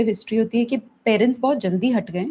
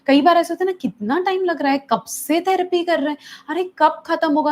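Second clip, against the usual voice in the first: impatient parent; 7 semitones higher, 3 dB louder than usual. Retold or imitated.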